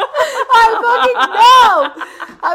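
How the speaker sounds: high, loud, very slow words squeezed out through laughter; laughing so hard it almost sounds like crying; real laughing fit. Real, not acted. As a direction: barely get it out while cracking up. Laughing fit after friend's joke.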